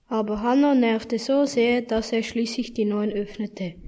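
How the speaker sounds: ordinary delivery, nothing marked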